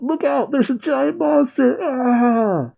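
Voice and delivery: high-pitched mock scream of alarm; agents' warning squealed up high; panicked cry. Agents warning each other of a giant monster, high panicked cry. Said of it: "as they cry out".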